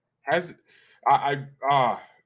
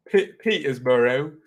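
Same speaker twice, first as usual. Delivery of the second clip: slow, louder mock English accent; put-on English accent; 2 semitones higher, 6 dB louder, slower than usual. Streamer repeating a viewer's town, put-on English accent. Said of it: "why am I doing an accent".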